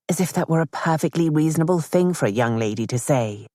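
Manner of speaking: ordinary delivery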